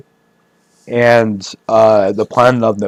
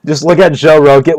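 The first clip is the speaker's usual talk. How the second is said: normal talking voice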